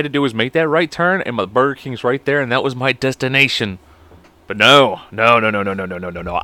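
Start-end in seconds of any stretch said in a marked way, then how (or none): 4.6-6.4 mock emphatic no. Refusing to let it go.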